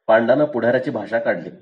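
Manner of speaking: ordinary delivery, nothing marked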